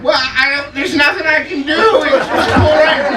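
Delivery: high, sheepish mock whine; helpless driver. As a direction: play the flustered guy giving up. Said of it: "looking in his mirror going".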